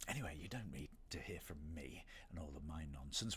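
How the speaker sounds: hushed, eerie whisper into the mic. Pretend. Streamer performing the cameo transition for chat, in a whisper. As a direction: breathe it out as a creeping voice. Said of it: "Did you see the transition?".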